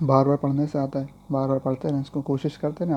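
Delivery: ordinary talk, nothing marked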